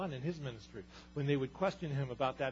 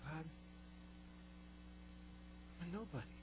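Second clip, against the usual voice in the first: much softer, self-pitying mumble; small whiny excuse; 5 semitones higher, 14 dB quieter than usual. Retold or imitated. Preacher voicing Moses' excuse to God, soft and pitiful. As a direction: make yourself small and pitiful.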